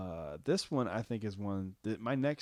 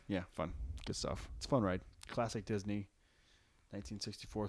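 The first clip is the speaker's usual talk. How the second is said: usual speaking voice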